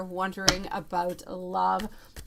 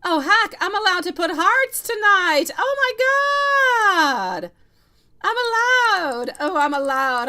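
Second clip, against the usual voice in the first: high, loud squealing joy, drawn out; thrilled shout; 11 semitones higher, 10 dB louder, slower than usual. Real, not acted. Reader thrilled she can send viewers hearts, squealing shout. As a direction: shriek with giddy freedom.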